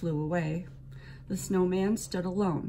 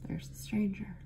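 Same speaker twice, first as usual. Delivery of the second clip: hushed whisper; 2 semitones higher, 6 dB quieter than usual. A narrator's reading. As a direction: breathe it so the stranger won't hear. Hushed sighting of stranger.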